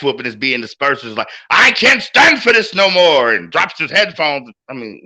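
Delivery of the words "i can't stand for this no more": a clumsy mock Spanish accent, higher, louder and slow; bad Spanish accent. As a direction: fake a Spanish-accented commentator quitting the booth in protest. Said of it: "That's a terrible Spanish accent".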